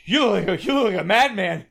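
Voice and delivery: high, urgent mock plea; comic desperation, rising in pitch and volume; hammed-up pleading. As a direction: beg for help as a panicked groundhog. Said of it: "Phil here is pleading".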